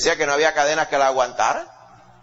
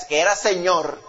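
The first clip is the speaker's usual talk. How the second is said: usual speaking voice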